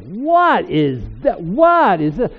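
loud, high, frightened cry in the dark; startled night fright. Retold or imitated. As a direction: act out waking up scared of something unseen. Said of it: "And I was like".

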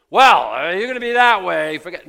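very loud, high scornful dismissal; dismissive brush-off. Pretend. An imagined God rejecting unfaithful people, loud and scornful. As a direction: wave them off with contempt.

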